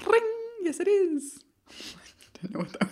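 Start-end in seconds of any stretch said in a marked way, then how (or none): 0.0-1.4 silly high squeak. Pretend. Sudden silly interjection.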